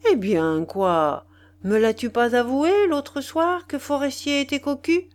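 high, loud, taunting question; insolent mockery. Husband taunting wife about Forestier, insolent and jeering. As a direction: needle her with a jeering tone. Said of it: "l'air insolent et railleur".

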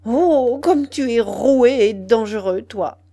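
drawn-out, loud "oh", then falling away; quivering indignation. A narrator's reading. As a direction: let indignation swell on the first words. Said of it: "Elle murmura, frémissante".

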